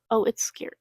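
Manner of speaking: ordinary delivery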